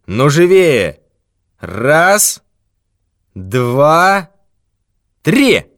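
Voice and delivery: slow, heavy threats; threatening thief. Acted. Thief threatens old man with death, menacing. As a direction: promise him his last hour.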